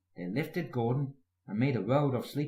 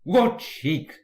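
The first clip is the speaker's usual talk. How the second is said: louder indignant splutter; 5 semitones higher, 8 dB louder than usual. A narrator's reading. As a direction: splutter it out, offended. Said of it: "spluttered Gordon".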